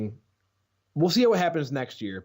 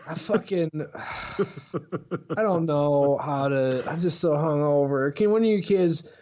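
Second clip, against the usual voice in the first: hungover teacher. Acted. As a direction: groan through it like a hungover teacher. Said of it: "he was the hangover teacher".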